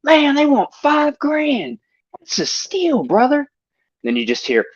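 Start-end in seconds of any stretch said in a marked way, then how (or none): 0.0-3.5 mock Buffalo Bob. Retelling the Buffalo Bob scene in a high slow drawl. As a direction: drag it out like a hillbilly. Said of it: "and he goes".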